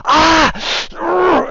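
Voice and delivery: sudden high pained yelp from the struck foe; pained cry. Acted. GM voicing the stabbed enemy's loud, high pained yelp. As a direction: yelp as the sword goes into his clavicle.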